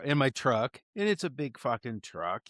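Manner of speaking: ordinary delivery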